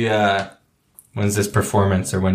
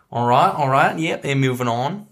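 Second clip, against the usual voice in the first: put-on British accent; 6 semitones higher than usual. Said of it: "like, a British".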